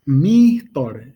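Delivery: mitor said loud, mi hit hard; stressed mi. Shown as a demonstration. Teacher pointing learners to the first syllable. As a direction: punch the mi in mitor. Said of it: "the mi in that mitor".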